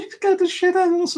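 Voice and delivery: a stranger caught out in the dark, squeaky mock shock; startled passer-by. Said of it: "He goes".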